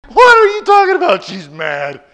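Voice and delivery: dad scoffing at a silly question; indignant dad. Retold or imitated.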